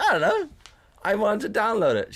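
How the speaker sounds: high-pitched, defensive protest. Retold or imitated. Answering her questioning about the game, defensive. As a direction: plead innocence with the pitch shooting up.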